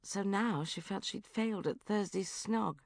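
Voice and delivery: usual speaking voice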